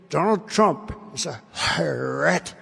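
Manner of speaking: slow, drawn-out mockery of a rival; mock the accuser, dragging out the last word; mocking quote. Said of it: "He always says".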